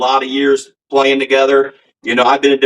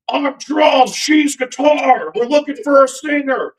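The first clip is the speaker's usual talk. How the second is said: put-on high, strong Philly accent; JoJo Philly accent; 12 semitones higher, slower than usual. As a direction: imitate a Philly guy, pitched way up. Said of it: "I do his perfect Philly accent too".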